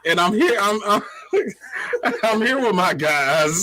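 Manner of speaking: can't stop laughing while speaking. Real, not acted. Giggling intro while laughing.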